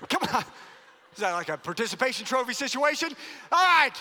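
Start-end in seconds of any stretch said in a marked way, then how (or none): 3.5-3.9 loud grudging concession. Real, not acted. Pastor concedes defeat loudly.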